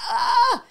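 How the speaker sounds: sharp high yelp of pain. Retold or imitated. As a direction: cry out as the plate squeezes. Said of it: "I'm like".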